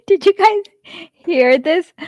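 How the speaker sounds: high burst of laughter into the words; laughing too hard to speak. Real, not acted. Streamer asking viewers while laughing. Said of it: "why I'm laughing so much".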